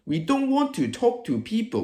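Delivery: ordinary talk, nothing marked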